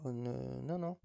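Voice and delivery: ordinary talk, nothing marked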